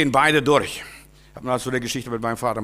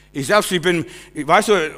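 ordinary delivery

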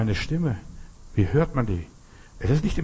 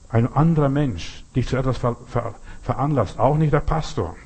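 ordinary talk, nothing marked

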